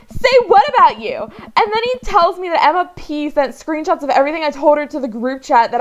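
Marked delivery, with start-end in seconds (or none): 0.1-1.2 retold indignant reply. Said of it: "And I'm like".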